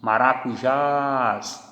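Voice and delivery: Maracujás drawn out, weight on the final jás; slow stress demo. Shown as a demonstration. Teacher showing the class the stress in Maracujás. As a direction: stretch Maracujás and land on jás. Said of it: "vejam que aqui está a sílaba tônica".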